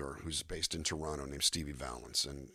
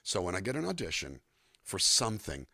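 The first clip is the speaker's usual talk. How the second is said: ordinary talk, nothing marked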